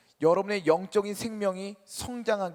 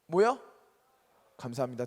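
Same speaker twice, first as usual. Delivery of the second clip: ordinary talk, nothing marked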